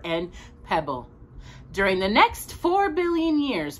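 storybook voice for a little listener; gentle read-aloud narration; reading to a child. A narrator's reading. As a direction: read it like a bedtime picture book. Reads picture book to child.

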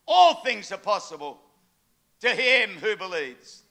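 slow, loud declarations; full-force preacher cadence; preaching. Acted. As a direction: proclaim each line with force.

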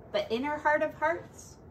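ordinary delivery, nothing marked

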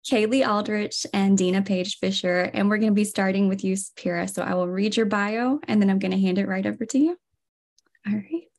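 normal talking voice

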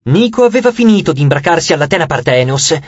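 plain reading voice

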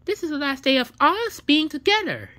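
defiant partner echoing the breakup; loud echo. Acted. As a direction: throw the words back at them. Other partner echoes it loudly.

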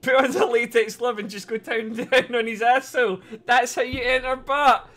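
loud and high, laughing through it; yelling the joke out, cracking up; excited shout. Real, not acted. Streamer yelling a crude joke to chat, loud and high.

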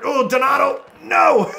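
high, rising to a shout; alarmed cry. Real, not acted. Alarm at a play.

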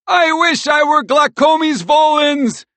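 high, loud, drawn-out wail; despairing high-pitched cry. A narrator's reading. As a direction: wail it out in a squirrel squeal. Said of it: "Merle cried".

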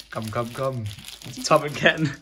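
usual speaking voice

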